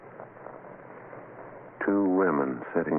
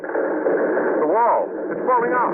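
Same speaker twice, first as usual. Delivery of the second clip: loud, much higher alarmed call; alarmed cry; 5 dB louder, slower than usual. Acted. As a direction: cry out as the wall gives way. Wall cracking open, alarm.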